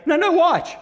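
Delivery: put-on giggly little kid insisting; insistent toddler. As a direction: squeal like a toddler begging to be watched. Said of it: "three-year-old that goes".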